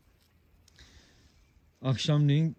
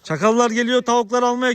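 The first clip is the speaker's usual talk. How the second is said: high, loud projected chat; calling across; 10 semitones higher, 7 dB louder than usual. Real, not acted. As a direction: raise your voice to carry to a distant neighbour. Shouted chat with distant villager. (uzağa duyurmak için yüksek sesle)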